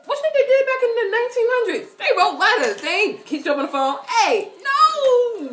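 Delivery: loud, high comic outburst; high-pitched, emphatic hollering; playful rant. Real, not acted. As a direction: holler it at a friend, making your point. Playful rant about writing letters.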